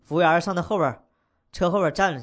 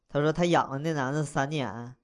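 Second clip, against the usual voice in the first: ordinary delivery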